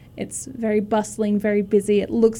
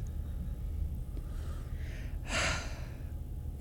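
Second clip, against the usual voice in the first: long, soft, breathy sigh; acted weary captain's sigh; exasperated sigh; 16 dB quieter than usual. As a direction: let out a long put-upon breath. Annoyed captain sighs before answering.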